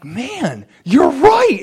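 overjoyed, high-pitched man, you're right; excited realization. Retold or imitated. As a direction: shout it out like you just won. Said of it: "But I'm like".

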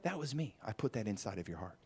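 soft and low, a gentle whisper-close reply; hushed voice of God. Pretend. God speaking softly into the believer's heart. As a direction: speak as God, quietly confiding.

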